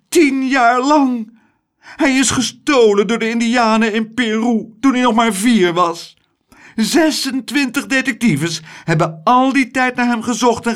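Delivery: a society lady hamming up her grief; overwrought rich lady. Acted. Rich lady gushes to the ladies over her lost son, high and loud.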